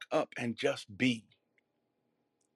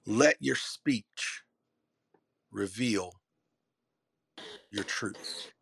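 slow, fading repeat; 3 semitones lower, much slower than usual. Shown as a demonstration. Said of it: "One more time".

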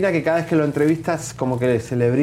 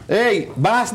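scolding the dog; 5 semitones higher, slower than usual. Real, not acted.

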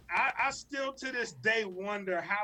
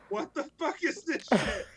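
appalled disbelief; 7 semitones higher, 3 dB louder than usual. Retold or imitated. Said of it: "her face was like".

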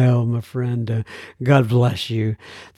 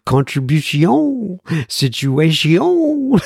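cartoon French, higher and drawn out; Pepe Le Pew French accent; 4 semitones higher, slower than usual. Shown as a demonstration. Host showing the French he practices, Pepe Le Pew French accent. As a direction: stretch the word endings in a hammed-up French accent. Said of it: "You practice what they call Pepe Le Pew French".

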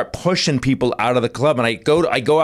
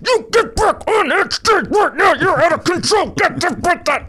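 very high, screechy mock tirade; furious wife. Wife ordering comic back onstage, high screeched mock scolding. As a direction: screech it right into his face. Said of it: "She gets two inches from my face".

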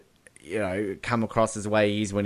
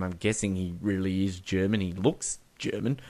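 normal talking voice

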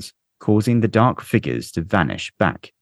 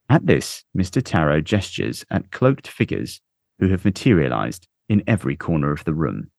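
plain reading voice

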